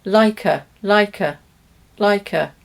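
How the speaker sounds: "a" as a schwa, "like a" run together; light linked "laikə"; weak form. Shown as a demonstration. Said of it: "We change the vowel in the weak forms".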